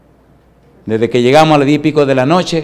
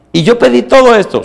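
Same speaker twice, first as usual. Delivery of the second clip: raised, incredulous pitch; amazed question; 8 semitones higher than usual. Retold or imitated. Pastor asks the waiter about the huge order, incredulous. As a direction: ask like you can't believe the portion.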